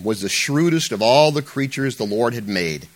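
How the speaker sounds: usual speaking voice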